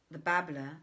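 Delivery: usual speaking voice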